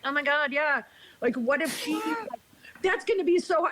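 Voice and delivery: mock alarmed chiming-in; imitated worried friends. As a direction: mimic friends fretting along in a high voice.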